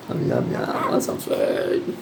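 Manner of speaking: slow unvoiced whisper. Owner offering lorikeet food in a whisper. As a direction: breathe it to the bird.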